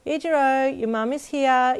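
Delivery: put-on high, loud call to the boy. Mum calling her son's friend at the door, loud. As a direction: call him from across the house. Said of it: "your mum called".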